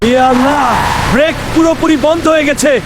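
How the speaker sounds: high, urgent cries as the bus goes out of control; desperate panic. Acted. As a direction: shout for help, then beg forgiveness. Driver losing control near cliff.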